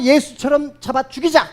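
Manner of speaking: rally the crowd to kill; imagined mob shout. Retold or imitated. Council members crying out against the apostles, mock shout. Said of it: "이렇게 소리쳤을 거예요".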